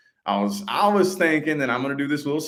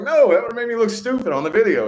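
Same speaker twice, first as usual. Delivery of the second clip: high, fast, louder objection; retold protest; 12 semitones higher, 6 dB louder, faster than usual. Client objecting to being told to laugh, retold protest. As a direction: push back in a raised, hurried pitch.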